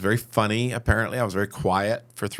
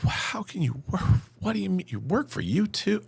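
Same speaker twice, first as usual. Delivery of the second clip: incredulous; 10 semitones higher than usual. Retold or imitated. Incredulous questions to crew.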